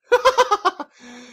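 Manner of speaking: high burst of laughter; loud laugh. Real, not acted. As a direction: crack up laughing. Laughing at own joke.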